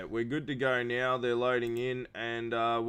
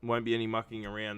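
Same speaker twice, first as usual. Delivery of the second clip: usual speaking voice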